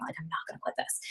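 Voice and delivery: a soft unvoiced whisper to herself; whispered resolve. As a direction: whisper it like a private vow. Inner resolve quoted to herself.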